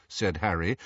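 ordinary delivery

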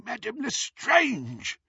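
high, slow startled goblin. Acted. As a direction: gasp her name in surprise. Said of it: "evidently startled".

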